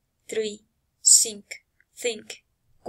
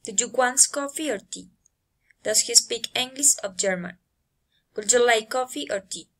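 "coffee" and "English" go up, "tea" and "German" come down; rising and falling intonation. Shown as a demonstration. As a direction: lift the first choice "coffee", "English", drop the last "tea", "German". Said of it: "rising and falling intonation".

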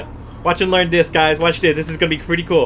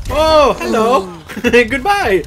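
mock greeting; 8 semitones higher, 6 dB louder, much slower than usual. Real, not acted. Sees zombie, mock greeting.

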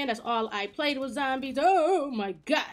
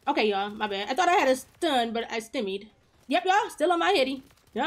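ordinary delivery

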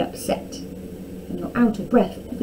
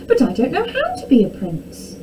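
put-on higher, worried storybook boy; dismayed cry; 6 semitones higher than usual. A narrator's reading. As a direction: protest in mild dismay. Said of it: "cried Tom".

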